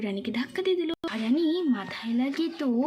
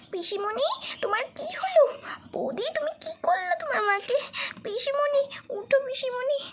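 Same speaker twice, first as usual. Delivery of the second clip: high-pitched anxious cry; alarmed; 11 semitones higher, slower than usual. Acted. Girl finding her aunt fallen, high alarmed pleading. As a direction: call out in high distress. Chhaya finds aunt hurt on the ground.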